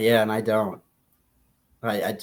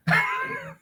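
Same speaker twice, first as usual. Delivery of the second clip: high laugh. Real, not acted. Laughs at own luck preference.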